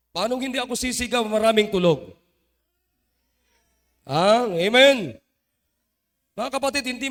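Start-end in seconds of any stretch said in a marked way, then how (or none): 0.1-5.2 fast, then a much louder burst; real shouting. Real, not acted. Preacher defending his shouting to the congregation, real shouting. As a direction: shout it out at the sleepers. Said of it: "kasi sumisigaw ako".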